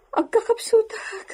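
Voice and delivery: high, slow tearful plea; faint and close to tears; weak tearful. Acted. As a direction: voice breaking as she feels faint. Young woman feeling faint.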